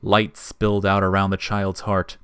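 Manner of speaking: ordinary delivery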